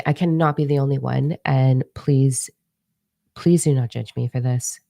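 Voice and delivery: ordinary talk, nothing marked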